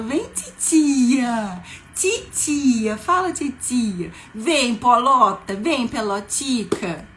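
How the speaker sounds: put-on auntie cooing loudly to a toddler; cutesy baby talk. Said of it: "falar tão fofinho, por exemplo".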